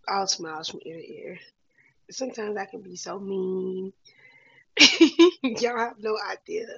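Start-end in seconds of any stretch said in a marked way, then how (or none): 4.7-5.4 big loud laugh, high and bright; sudden hearty laugh; loud burst of laughter. Real, not acted. Laughs at own meanness.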